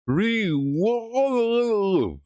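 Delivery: long, high sung-out reward; drawn-out silly read. Pretend. Streamer mocking the game's word, high drawn-out. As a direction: stretch the word out high and goofy. Stretched mock reading.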